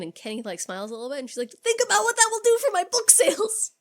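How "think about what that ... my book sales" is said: mock greedy quote. Sidney's mom character retold.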